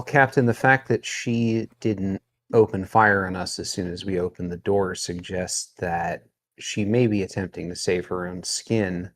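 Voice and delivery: normal talking voice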